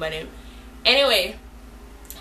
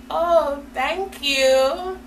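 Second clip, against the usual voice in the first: higher, sing-song politeness; mock gracious thanks; 6 semitones higher, much slower than usual. Storyteller answering the girls' flattery, mock gracious. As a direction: lift the voice in put-on sweetness.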